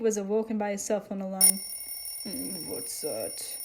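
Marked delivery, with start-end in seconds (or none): 2.2-3.6 slow, low, very soft mumble; sleepy mumble. A narrator's reading. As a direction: mumble it half asleep. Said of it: "he mumbled as he turned".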